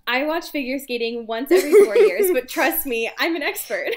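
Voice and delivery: giggling read of a silly title; laughing reading. Real, not acted. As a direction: read it while cracking up.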